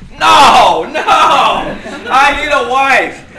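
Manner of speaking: loud, high, laughing refusal; hammed-up horror at life without a wife; panicked protest. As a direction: shout the no's, then laugh through the words. Retelling his panicked protest.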